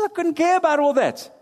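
high, careless scoff; dismissive queen. Retold or imitated. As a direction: toss it off with contempt. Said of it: "She says".

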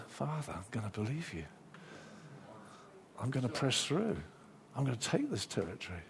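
quiet prayer. Retold or imitated.

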